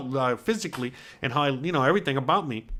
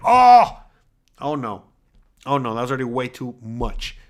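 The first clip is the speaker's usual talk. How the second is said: startled oh, then a let-down oh no; shocked oh no; 3 semitones lower, 7 dB louder than usual. Retold or imitated. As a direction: gasp at the bad news, then deflate. Shock at the scale weight.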